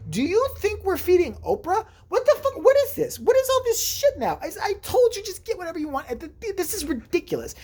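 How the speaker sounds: very high-pitched mock scolding; comic exasperated squeal of complaint; exasperated outburst. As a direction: rant in high-pitched disbelief, replaying an argument. Retold outburst at smuggled candy.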